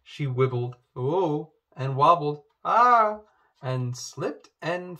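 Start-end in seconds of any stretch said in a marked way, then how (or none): 1.0-1.5 surprised. A narrator's reading. Wobbling on a stone. 2.6-3.2 loud, high yelp of alarm; sudden high squeal of falling; startled cry. A narrator's reading. Slipping into the pond.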